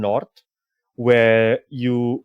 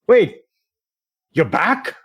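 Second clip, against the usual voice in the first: high, loud surprised call; 8 semitones higher, 6 dB louder, slower than usual. Acted. Stranger's voice hails a returning adventurer, surprised. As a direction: call out in startled recognition. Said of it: "you hear, like, a voice go".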